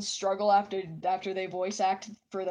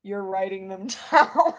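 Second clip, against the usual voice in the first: louder, words broken by laughter; real laughter; laughing; 9 dB louder than usual. Real, not acted. Laughing at chat.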